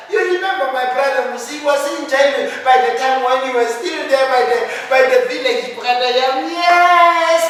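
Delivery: high, loud, gushing woman flattering a rich relative; high-pitched begging woman. Acted. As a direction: fawn over him in a shrill high voice, laughing.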